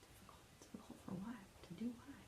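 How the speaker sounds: hushed, breathless puzzled whisper; soft inner-thought whisper. A narrator's reading. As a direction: wonder it under your breath.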